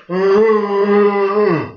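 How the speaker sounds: loud high groaning arr; a long moaning arr; corpse moan. Retold or imitated. Undertaker imitating the dead man's groan. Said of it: "That was after he went".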